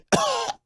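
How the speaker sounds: fake choking cough. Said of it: "I can't do it".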